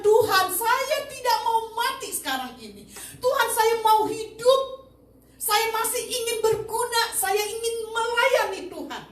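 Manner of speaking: high, loud, desperate prayer; tearful pleading. Retold or imitated. Cancer patient begging God for life, tearful pleading. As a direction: beg God with everything you have. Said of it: "dalam keadaan saya menangis".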